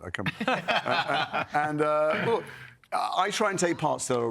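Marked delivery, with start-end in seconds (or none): 0.0-2.4 laughing. Real, not acted. Mock offence at question.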